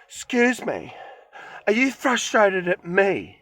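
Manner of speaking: high-pitched mock indignation; affronted lady. Retold confrontation with pharmacy lady.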